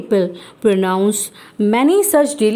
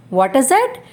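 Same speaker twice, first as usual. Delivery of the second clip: ordinary delivery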